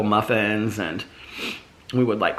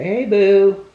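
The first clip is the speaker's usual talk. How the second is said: high, louder sing-song "Hey, boo."; cooing greeting; 11 semitones higher, 7 dB louder than usual. Retold or imitated. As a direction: call out to the dog sweetly. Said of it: "They always say".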